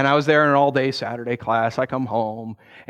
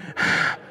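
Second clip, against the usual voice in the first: weary sigh. Retold or imitated. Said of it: "I'm just like".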